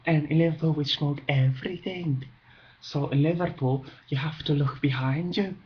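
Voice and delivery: lightly voiced Liverpool accent; a hint of a Liverpudlian seller. A hint of a Liverpool cigarette seller's accent, boasting about his city.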